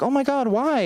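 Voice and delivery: high, shocked front desk clerk. Retold or imitated. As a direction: gasp it out high and alarmed. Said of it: "And they're like".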